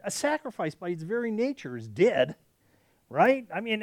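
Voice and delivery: normal talking voice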